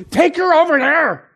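high barked order then dropping off; innkeeper ordering. Retold or imitated. Innkeeper sending Joseph and Mary to the hay, bossy order. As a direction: point and command, then mutter it again. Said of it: "and he goes".

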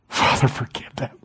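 hushed, breathless plea to the Father; whispered prayer. Acted. Preacher voicing Jesus praying for his killers, whispered. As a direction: whisper it like a dying man.

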